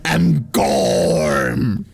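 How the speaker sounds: slow, far-off villain monologue; sneering gloating dark voice. Pretend. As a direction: gloat like a petty necromancer. Voicing villain Gorm the Moldy.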